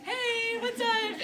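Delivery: high, drawn-out breezy greeting; high singsong hello, playfully nonchalant; mock casual. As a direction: imitate someone strolling in all casual. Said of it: "walks down all casually".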